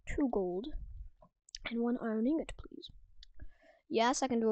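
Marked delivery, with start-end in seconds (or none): 0.1-2.9 put-on higher-pitched, polite British accent. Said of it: "Just gave myself a British accent".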